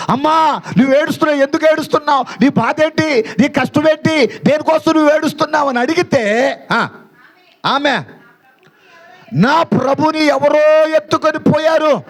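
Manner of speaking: high-pitched, sustained declamation; impassioned preaching. Acted. (ఉద్వేగంతో ఎత్తైన స్వరంలో ప్రసంగం)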